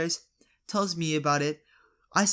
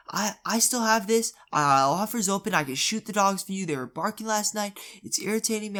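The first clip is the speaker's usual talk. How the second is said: higher, louder mock insistence; insistent neighbour; 6 semitones higher, 5 dB louder than usual. Neighbour with a BB gun presses dad, insistent. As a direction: push the offer, imitating a pestering neighbour. Acted neighbour offering to shoot dogs.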